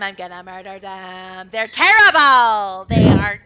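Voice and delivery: an excited dog bragging; eager dog. Acted. The good boy answers Kai, loud eager puppy voice.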